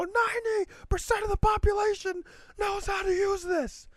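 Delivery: very high, strained rant at ordinary volume; exasperated mock scream. Shopper berating family member over self-checkout, exasperated.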